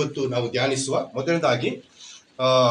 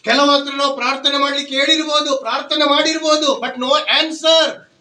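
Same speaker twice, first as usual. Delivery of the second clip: higher and louder, building preacher's cry; fiery preaching; 9 semitones higher, 7 dB louder than usual. Acted. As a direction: proclaim it over the weeping woman. Preaching at full force. (ಉತ್ಸಾಹದ ಪ್ರವಚನ)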